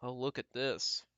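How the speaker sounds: ordinary delivery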